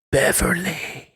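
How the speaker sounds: slow, drawn-out ghostly call; mock haunting moan of a name; ghost impression. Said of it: "That's a reference to the Ghost episode".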